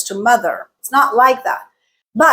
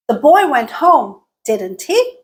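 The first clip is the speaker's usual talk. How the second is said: questioning upturn on DIDN'T HE; rising tag question; 2 semitones higher than usual. Shown as a demonstration. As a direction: pitch climbs on the tag DIDN'T HE. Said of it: "where our rising pitch is happening".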